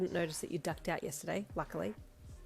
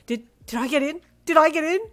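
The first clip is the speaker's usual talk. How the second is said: high, loud, stammering question rising; excited disbelief; 9 semitones higher, 15 dB louder than usual. A narrator's reading. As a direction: build from stunned to shouting. Student learns of college news.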